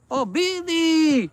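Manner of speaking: high, drawn-out greeting call. Real, not acted. Owner spots Billy returning, calling out. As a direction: stretch the cat's name up high.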